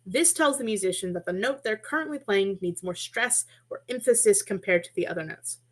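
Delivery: usual speaking voice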